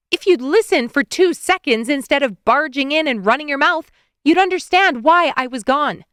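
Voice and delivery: yelling. Acted. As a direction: snap back at him loudly. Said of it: "She hadn't meant to yell".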